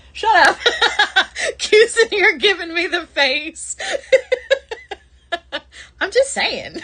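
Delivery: laughing, loud and high; helpless real laughter, pitched high; cracking up. Real, not acted. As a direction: protest through bursts of laughter. Cracking up at teasing.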